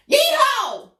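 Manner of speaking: high, loud cowboy yeehaw; yeehaw shout. Acted. Storyteller to kids, a loud cowboy whoop.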